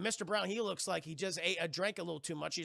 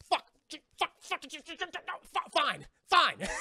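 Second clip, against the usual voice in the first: high, stuttered comic cursing; mock frustration; 9 semitones higher, 6 dB louder, slower than usual. Pirate struggles to free the stuck sword, comic exasperation. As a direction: splutter curses, then give up on a burst.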